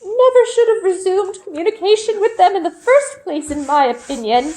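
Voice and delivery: put-on gossiping old woman tutting at the council; fussy old woman. Elderly Olga voiced.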